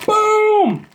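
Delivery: high, loud, drawn-out boom; showy exclamation. Pretend. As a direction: belt it out like a big product reveal. Showy reveal of each candy.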